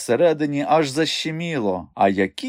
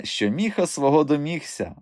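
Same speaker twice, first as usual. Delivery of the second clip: ordinary delivery, nothing marked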